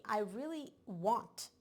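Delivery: want slowed and weighted; stress on want. Shown as a demonstration. Reader voicing the client's longing, stress on want. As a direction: lean on the word want, drawn out slowly. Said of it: "emphasis on the want".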